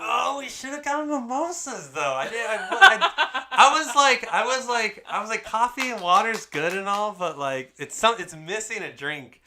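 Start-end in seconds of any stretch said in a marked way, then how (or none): 0.0-6.9 laughing. Real, not acted. Laughing about forgetting mimosas.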